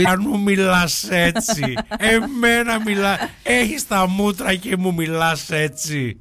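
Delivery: very slow, high drawn-out outrage; mock indignation. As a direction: stretch every word in hammed-up offence. Same mock indignation, repeated. (ψεύτικη αγανάκτηση, αργά και συρτά)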